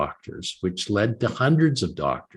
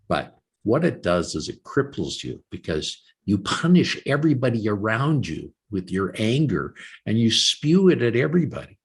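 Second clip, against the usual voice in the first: normal talking voice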